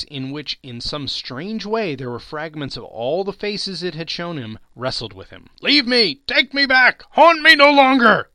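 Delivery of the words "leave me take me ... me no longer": much higher, much louder frantic yells; desperate shouting. A narrator's reading. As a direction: fight him off, shouting. Scrooge wrestles with the ghost.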